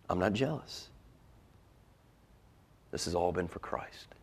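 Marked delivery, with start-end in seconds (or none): 2.9-4.1 hushed whisper; breathy whispered aside. Real, not acted. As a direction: drop to a whisper for weight.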